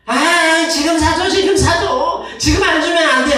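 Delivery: high, loud whining tantrum; child's tantrum. Retold or imitated. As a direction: wail like a kid demanding ice cream now.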